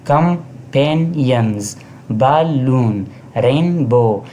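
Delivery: Companions, balloon, rainbow each broken into beats; syllable by syllable. Shown as a demonstration. Teacher showing syllables in example words, each one separated. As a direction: sound out com-pan-ions, bal-loon, rain-bow with gaps. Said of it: "companions के अंदर तीन syllable है".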